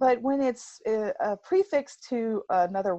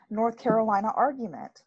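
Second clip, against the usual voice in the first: ordinary talk, nothing marked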